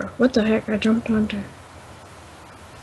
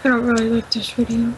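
ordinary delivery

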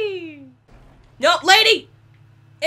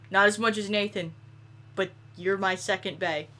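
mock tender; 6 semitones lower than usual. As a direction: sweet-talk the train like a lover. Making up with the train.

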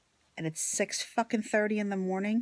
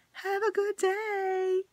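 saccharine sign-off; 12 semitones higher, slower than usual. Acted. Sarcastic sign-off to viewers.

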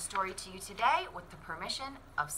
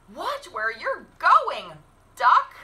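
higher, slower scolding hen voice; higher annoyed chicken warning; cross chicken; 11 semitones higher, 15 dB louder, much slower than usual. Acted. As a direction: scold the duck as a fussy hen. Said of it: "what she thought was".

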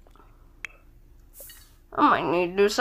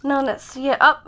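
ordinary talk

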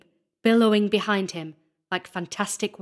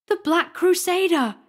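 high, loud cry of terror; panicked shriek; terrified scream; 10 semitones higher, 6 dB louder than usual. A narrator's reading. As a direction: scream it in fright, pitch way up. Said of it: "he screamed".

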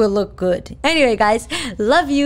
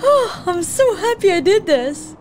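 high, teary happy exclamation; overjoyed; 9 semitones higher than usual. Real, not acted. Gamer thrilled after beating the mission, high happy voice. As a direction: gush with real joy.

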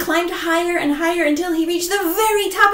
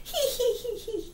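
soft fox chuckle; 9 semitones higher, 7 dB quieter than usual. Acted. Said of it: "still chuckling to herself".

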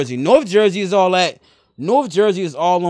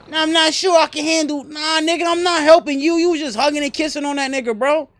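indignant refusal; 9 semitones higher, 3 dB louder than usual. Pretend. Indignant refusal to help player.

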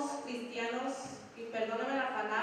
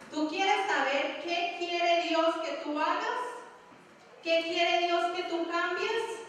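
slow, raised challenging questions; pointed question; 4 semitones higher, 3 dB louder, slower than usual. Real, not acted. Preacher challenging the congregation to read Scripture. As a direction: press each question on the listener.

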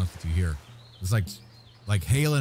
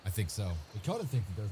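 ordinary delivery

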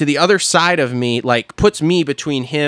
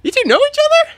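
high, airy clueless voice; dumb blonde voice; much faster than usual. Retold or imitated. To his buddy and the stranger, clueless high voice. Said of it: "am a dumbass blonde going".

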